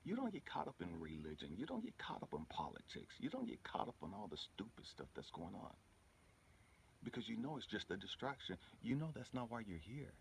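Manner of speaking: ordinary talk, nothing marked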